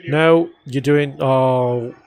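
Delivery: ordinary delivery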